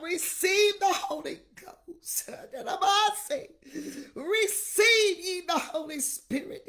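loud, rising preacher cry with bursts of tongues; fiery preaching. Real, not acted. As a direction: pastor pleading with the church, preaching at full force. Preaching with tongues at full force.

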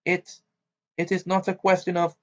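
stammering. A narrator's reading. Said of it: "she stammered".

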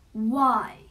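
very loud, booming demand; thundering troll. A narrator's reading. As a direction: thunder it at the little goat. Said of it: "the troll thundered".

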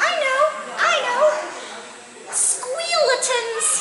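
high, bright-eyed little bunny, pleased with itself; tiny bunny voice. Acted. Little bunny Sword answering Kristoff's riddle, squeaky and proud.

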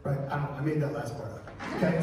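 ordinary talk

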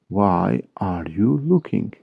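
"Why are you looking" slowly, word by word; slow model repeat. Shown as a demonstration. English teacher repeating a question for Turkish learners, slow model repeat. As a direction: lengthen every word for learners.

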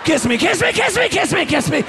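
high, pleading kiss me, arms shaking; mock straining lover. Man straining over his girl, begging kiss me in a high voice.

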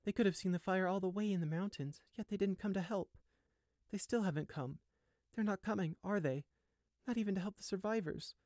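high, hurt character voice; distraught young sheep. A narrator's reading. Abandoned sheep wonders why no help came, distraught. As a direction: let the hurt sit high in the voice.

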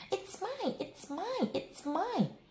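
put-on high, childish whining "mine"; mock frog squabble. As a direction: mimic the bickering frogs. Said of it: "I can hear you shouting".

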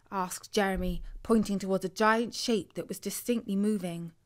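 usual speaking voice

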